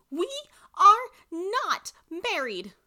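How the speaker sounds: slow, high, loud scream of protest. A narrator's reading. Granddaughter screamed at the man carrying her, slow loud outburst. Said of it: "I screamed at him".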